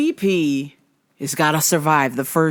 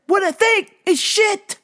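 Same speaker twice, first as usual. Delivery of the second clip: very high, exasperated snap back; outraged retort; 13 semitones higher than usual. Retold or imitated. Fan answering casuals about the fight, outraged. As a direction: cut the question off with a high, mock-furious answer.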